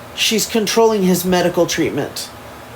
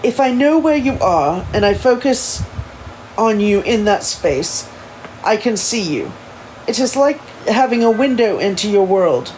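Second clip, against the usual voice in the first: put-on proper English accent, carefully paced; formal English accent, a little halting; Elijah; 3 semitones higher than usual. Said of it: "I'm gonna try that again".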